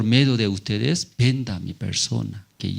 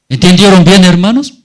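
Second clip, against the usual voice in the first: louder, higher call to the congregation; loud appeal; 8 semitones higher, 15 dB louder, faster than usual. Real, not acted. Preacher checking the congregation understood, loud call. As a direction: rouse the congregation to answer.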